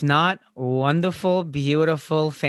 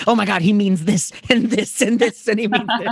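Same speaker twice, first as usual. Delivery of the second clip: gushing fan voice; 7 semitones higher than usual. Retold or imitated. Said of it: "everyone on TikTok".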